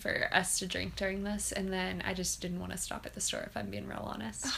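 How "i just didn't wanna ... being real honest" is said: whispered confession; whispering. Real, not acted. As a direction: whisper the admission. Confessing aside.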